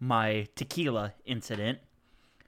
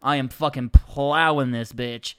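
ordinary delivery

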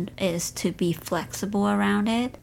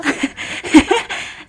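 breathy laugh; 7 semitones higher, 4 dB louder than usual. Real, not acted. Laughs after whisper joke.

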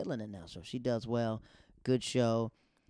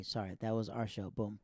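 ordinary talk, nothing marked

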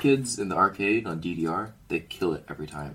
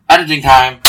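announcing; 8 semitones higher, 17 dB louder than usual. Real, not acted. Announcing the edit.

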